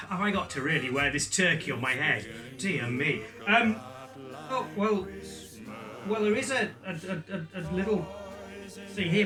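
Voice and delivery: usual speaking voice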